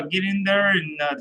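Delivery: ordinary delivery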